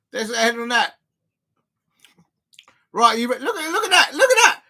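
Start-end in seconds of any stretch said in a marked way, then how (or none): 3.4-4.6 excited. Real, not acted. Amazed at beer head.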